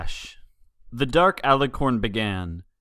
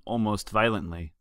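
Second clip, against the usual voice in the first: ordinary delivery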